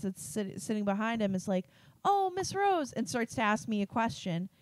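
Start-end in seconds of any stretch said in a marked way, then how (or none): 2.0-2.9 high sing-out for the teacher's attention; imitated student calling. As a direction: call to the teacher, pitched well up. Student interrupting teacher, retold.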